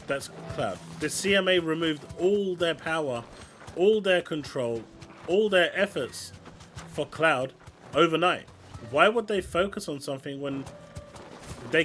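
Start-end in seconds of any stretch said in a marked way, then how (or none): none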